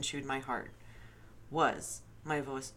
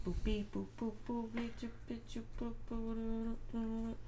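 higher, sing-song beep boop; mock censor beeps; 8 semitones higher, 5 dB quieter than usual. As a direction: imitate a bleep tone. Imitating a censor bleep.

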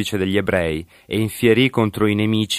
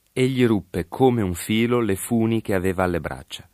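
plain reading voice

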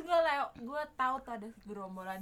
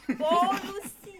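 drawn-out loud; 10 semitones higher, 13 dB louder, much slower than usual. Pretend. Echoing polusi loudly.

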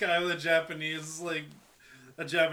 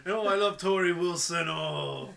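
loud, slow mock Japanese accent; Kenzo Suzuki; 3 semitones higher, 4 dB louder than usual. Wrestler begging to do a dance number, loud hammed-up Japanese accent. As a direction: ham up a swooning Japanese accent. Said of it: "He's like".

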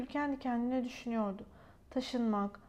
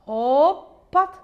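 loud hop; 3 semitones higher, 13 dB louder than usual. A narrator's reading. Sound of bottle falling.

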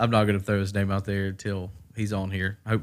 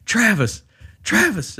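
high, raised-voice call of a name; 10 semitones higher, 5 dB louder, slower than usual. Retold or imitated. Driver outside calling his laughing friend, insistent call. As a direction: call him sharply from outside the truck.

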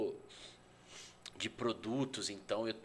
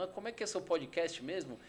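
confused listener; 6 semitones higher than usual. Retold or imitated. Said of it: "tem um monte de gente falando".

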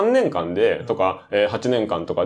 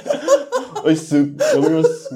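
awkward laughing reply; 4 semitones higher than usual. Retold or imitated. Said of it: "わけわかんないこととか言われた時とかに".

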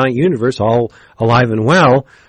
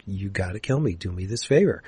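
softer, insistent request; pressing the issue; 3 semitones lower, 9 dB quieter than usual. Retold or imitated. Gilad pressing his brother to kill him, softer and insistent. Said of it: "Gilad presses the issue".

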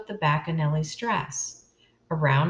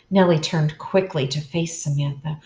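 ordinary delivery

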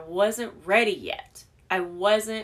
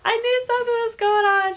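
high, loud and thrilled; excited friend; 14 semitones higher, 8 dB louder than usual. Retold or imitated. Friend calling to reassure her, high excited gush. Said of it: "she called me and she said".

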